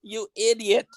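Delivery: lightly voiced, exasperated German officer; mock scolding in a German accent; Hogan's Heroes officer. SS officer scolding Klink, mock German voice. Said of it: "who was the guy from the S S".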